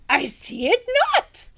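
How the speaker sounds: puzzled gallant knight; old knight voice. Acted.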